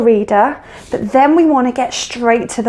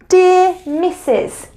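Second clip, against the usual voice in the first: slow demo; 6 semitones higher, much slower than usual. Shown as a demonstration. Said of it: "You might say".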